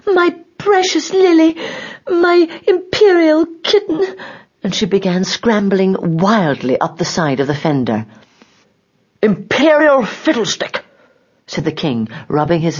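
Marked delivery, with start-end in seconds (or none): 0.1-4.2 high, loud, overwrought queen; crying out. A narrator's reading. 9.2-10.8 high, drawn-out indignant exclamation; annoyed king. A narrator's reading. King hurt by the fall, annoyed and exclaiming high. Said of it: "a right to be a little annoyed".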